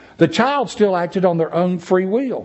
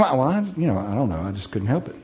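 lower, softer mock mumble of a lame excuse; 6 semitones lower, 6 dB quieter, much faster than usual. Preacher mocks adults excusing themselves, mumbled.

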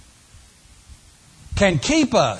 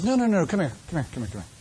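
tender calling; 2 semitones lower, 6 dB quieter, much faster than usual. Acted. God calling sinner back gently.

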